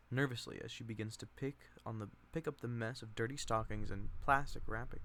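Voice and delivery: plain reading voice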